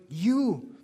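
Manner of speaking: "you" drawn out, pitched high and loud; emphatic, swooping "you"; stress on YOU. Shown as a demonstration. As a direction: punch the word "you". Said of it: "has an emphasis on the word".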